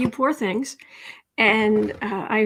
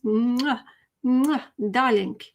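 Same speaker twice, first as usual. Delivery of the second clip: blown kisses; much slower than usual. Real, not acted. Blowing kisses to chat.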